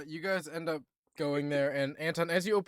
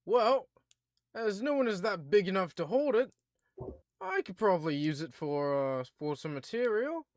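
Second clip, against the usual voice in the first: British dwarf; 5 semitones higher than usual. Acted. Dwarf blacksmith eyeing the giant axe, British voice. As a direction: weigh up the axe in a British accent. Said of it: "he looks at the axe and goes".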